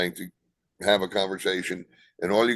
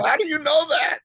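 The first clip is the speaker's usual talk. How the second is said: high, loud astonished cry; amazed disbelief; 6 dB louder than usual. Retold or imitated. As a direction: blurt it out like they can't believe it. Producer amazed at wrestling trivia.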